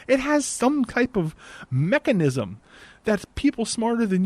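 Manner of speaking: high-pitched, emphatic rant; worked-up, exasperated telling-off; heated exasperation. Real, not acted. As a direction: scold her with slow, drawn-out key words. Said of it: "I'm getting a little hot already".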